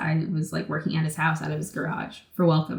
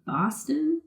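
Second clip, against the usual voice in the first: high-pitched reply; 10 semitones higher, 3 dB quieter than usual. Retold or imitated. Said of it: "I'm like".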